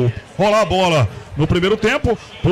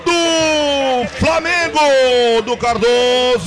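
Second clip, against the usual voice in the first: long drawn-out GOL call, much higher; explosive goal commentary; 8 semitones higher, 3 dB louder, much slower than usual. Real, not acted. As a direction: roar the goal, stretching it out. Goal scored in live commentary.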